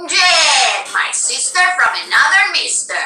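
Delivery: attempting a Brazilian accent that slips toward New York; New York-ish Brazilian attempt. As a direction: try the Brazilian line, drifting New York. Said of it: "we're going a little New York in there".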